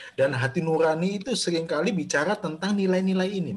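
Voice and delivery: normal talking voice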